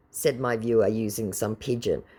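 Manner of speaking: normal talking voice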